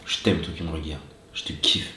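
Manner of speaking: low, soft loving murmur; soft and tender. Real, not acted. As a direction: whisper it tenderly to the viewers.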